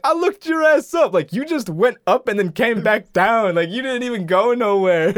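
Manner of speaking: high, loud mock taunt; heckling comeback. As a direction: imitate a kid talking back. Kid claps back at astronaut.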